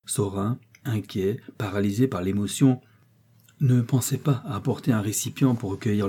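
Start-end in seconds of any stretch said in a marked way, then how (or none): none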